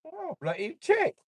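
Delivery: high, slow put-on English exclamation; hammed-up Brit; mock British accent.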